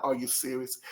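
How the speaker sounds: low, soft, unimpressed "Are you serious?"; dismissive disbelief. Shown as a demonstration. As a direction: say "Are you serious?" quietly and flatly, as if it doesn't work. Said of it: "No, it's not that kind of".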